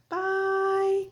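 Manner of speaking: high, bright drawn-out bye; sing-song goodbye. Real, not acted. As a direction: wave them off cheerfully. Cheery sign-off.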